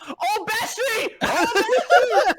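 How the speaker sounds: ecstatic yell. Retold or imitated. Friend surfacing while fighting fish.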